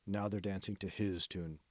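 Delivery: quiet grim remark. Acted.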